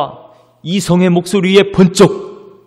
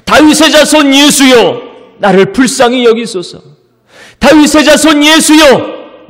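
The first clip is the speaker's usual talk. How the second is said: high, loud, drawn-out cry for help; sudden shouting; 10 semitones higher, 8 dB louder than usual. Acted. Blind beggar calling out to Jesus, shouting. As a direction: cry out desperately for mercy. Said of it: "갑자기 소리를 지르기 시작합니다".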